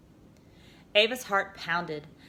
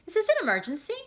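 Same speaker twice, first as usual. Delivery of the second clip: much higher machine query; device voice; 11 semitones higher, 3 dB louder, faster than usual. A narrator's reading. Omnipod checking on the girl, high machine voice. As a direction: ask it like a cheery program.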